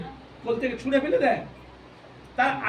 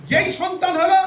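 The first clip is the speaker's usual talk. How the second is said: emphatic; 7 semitones higher, 6 dB louder than usual. Acted. Preacher stressing the turn.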